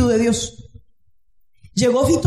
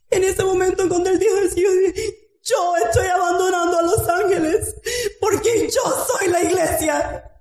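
full-force pulpit preaching; fiery preaching; 6 semitones higher than usual. Acted. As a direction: preach it out to the whole church.

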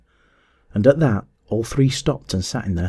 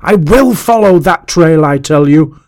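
loud, raised, insistent rage; enraged shout; 6 semitones higher, 9 dB louder than usual. A narrator's reading. Master defying the drifting globes, shouting with rage. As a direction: shout it out slowly, in a fury. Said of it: "He shouted aloud with rage".

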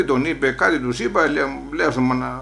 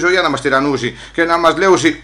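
usual speaking voice

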